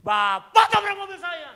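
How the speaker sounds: slow, high, very loud accusation; drawn-out furious insistence; acted angry shout. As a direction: yell each word at him. Acts out the shouted quarrel.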